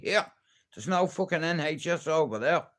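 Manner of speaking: ordinary talk, nothing marked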